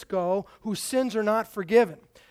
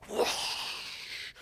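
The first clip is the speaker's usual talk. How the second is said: whoosh sound; 8 dB quieter than usual. Retold or imitated. Imitating fan blast for kids.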